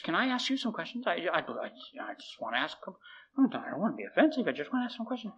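soft, high, hesitant pleading; timid request. Retold or imitated. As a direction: imitate a meek student asking permission. Timidly asking the priest for permission.

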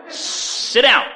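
loud, emphatic teacher's command; firm command. Retold or imitated. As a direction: rein the student in firmly. Teacher ordering eager student to sit.

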